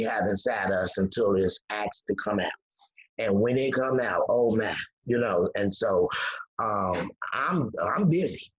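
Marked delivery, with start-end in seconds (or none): none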